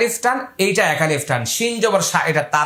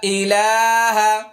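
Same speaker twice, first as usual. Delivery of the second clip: slow recitation; slower than usual. Shown as a demonstration. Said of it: "এবার মুখস্থ করে নিন".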